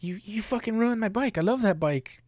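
high whining complaint about the bike; wrecked biker whining. Retold or imitated. Biker complains to the driver with a high whine. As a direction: whine it like a kid robbed of a toy. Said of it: "he's like".

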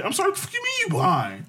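high, shocked squeal of disbelief; incredulous high-pitched outrage. Retold or imitated. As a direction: recreate the moment you heard she was blind, voice shooting up. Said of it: "I said".

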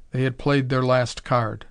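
plain reading voice

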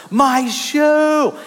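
little girl wailing over her lost slipper, mock yell; child yelling. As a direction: cry it out like an upset toddler. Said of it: "And she yells".

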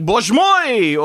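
loud, high Russian exclamation; Russian-accented comic-book hero shouting; Colossus Russian accent. Retold or imitated. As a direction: boom it out like a Russian strongman. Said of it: "Colossus would, would say stuff like".

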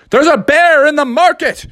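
high, urgent warning cry; alarmed trader. Acted. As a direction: raise the alarm across the floor. Trader raises alarm about bear.

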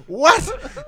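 loud, high incredulous yell; hammed-up shocked outburst; retold shock. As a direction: act out total disbelief. Acts out his shocked reaction.

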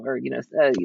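normal talking voice

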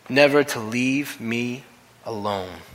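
slowly, weighing "never"; slow emphatic repeat. Shown as a demonstration. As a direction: stretch "never to leave me" out slowly. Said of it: "Jesus promised never to leave me".

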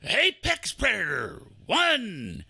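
rising battle-cry countdown. Acted. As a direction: pump yourself up like a soldier. Psyching up before entering corral.

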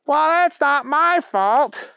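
high, drawn-out protest from Booger; put-upon, high character voice; whiny Booger. A narrator's reading. Booger denying blame to the bunny he ran over, high protest.

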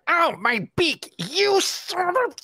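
high, slow squawky duck yelp; pained duck voice. Acted. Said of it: "you hear".

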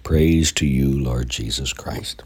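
normal talking voice

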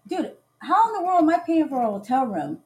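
ordinary delivery

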